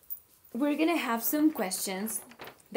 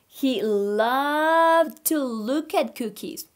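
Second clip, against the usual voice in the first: put-on reading to children; 3 dB louder than usual. Teacher reading a story to her online class, animated. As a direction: read aloud like a picture book for little kids. Reads picture book aloud.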